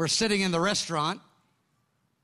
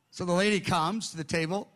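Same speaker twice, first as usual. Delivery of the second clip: ordinary talk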